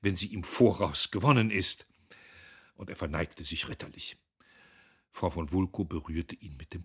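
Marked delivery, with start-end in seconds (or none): 0.0-1.9 louder, ready comeback; quick-witted. A narrator's reading. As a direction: deliver a smart retort firmly. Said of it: "sagte Dieterich schlagfertig".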